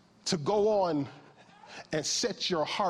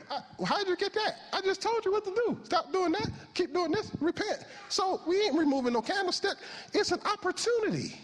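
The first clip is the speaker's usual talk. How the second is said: very high-pitched, incredulous protest; acted exasperation. Preacher voicing God answering a panicked reader, acted exasperation. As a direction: reel off the instructions in high-pitched disbelief.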